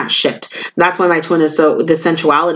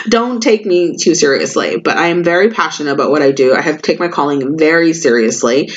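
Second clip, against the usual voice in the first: usual speaking voice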